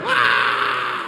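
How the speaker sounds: sudden cry then airy laughter; mock yelp and wheezy laugh. Imagined Elmer Fudd moment.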